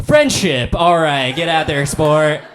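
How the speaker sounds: hammed-up coach shout; pep-talk rally. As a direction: fire up the kid before the game. Dad's pre-game pep talk, team chant.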